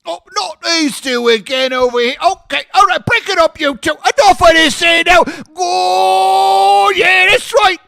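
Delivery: high, loud, hammed-up voice breaking up a scuffle; mock referee breaking up a fight. Host plays a bouncer breaking up two guests, hammed-up high shout.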